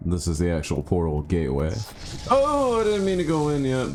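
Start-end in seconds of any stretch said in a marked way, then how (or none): 2.3-3.9 high startled yelp, settling gradually. Real, not acted. Player went into the portal too soon, startled then settling. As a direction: cry out high, then come down.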